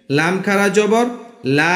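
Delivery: লা stretched one alif; drawn-out লা; long vowel la. Shown as a demonstration. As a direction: hold the vowel of লা. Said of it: "লামের উপরে খাড়া জবর".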